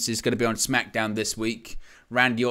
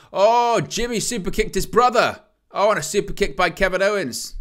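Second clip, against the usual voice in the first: higher, louder stunned call; shocked outburst; 8 semitones higher, 5 dB louder than usual. Real, not acted. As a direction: blurt it out in disbelief. Shock superkick on own brother.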